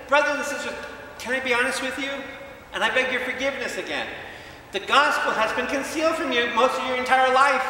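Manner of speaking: loud, high, impassioned sermon delivery; booming pulpit voice; fiery preaching. Acted. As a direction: preach it out with full force. Preaching at full force.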